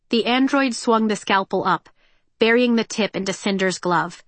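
ordinary delivery, nothing marked

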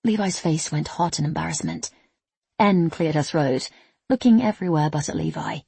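usual speaking voice

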